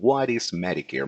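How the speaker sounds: ordinary delivery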